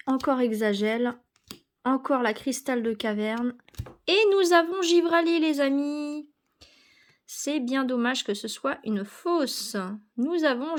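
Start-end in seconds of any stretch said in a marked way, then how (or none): 4.1-6.3 excited. Real, not acted. Excited reveal of the rare card.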